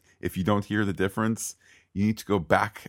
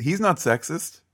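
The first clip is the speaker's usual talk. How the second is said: high, mock-innocent "not sexist"; sarcastic denial, tongue in cheek; 9 semitones higher than usual. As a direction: say it with heavy irony, pitched up. Sarcastic joke about villain.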